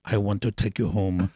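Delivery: put-on low, soft, seductive Brazilian accent; imitated Brazilian accent. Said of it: "said it in this beautiful accent".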